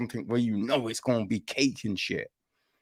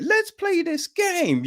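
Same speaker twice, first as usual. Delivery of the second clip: much higher, sing-song host voice; creepy game host. Retold or imitated. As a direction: toss it off like a sly party host. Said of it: "you get".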